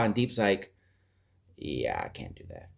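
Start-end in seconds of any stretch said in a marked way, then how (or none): 1.6-2.7 Deep refusing. Retold or imitated.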